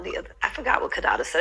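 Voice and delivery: ordinary delivery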